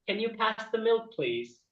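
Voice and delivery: lightly voiced polite woman; mock feminine polite request; normal feminine voice. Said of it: "they have a normal feminine voice".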